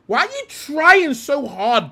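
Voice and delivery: exasperated. Real, not acted. Exasperated rant at footballer.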